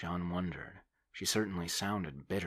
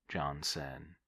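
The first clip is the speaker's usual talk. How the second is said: ordinary delivery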